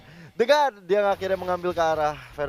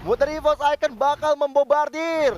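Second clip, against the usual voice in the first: high, loud hype commentary; excited co-caster; 9 semitones higher, 4 dB louder than usual. Acted. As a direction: ride the teamfight hype.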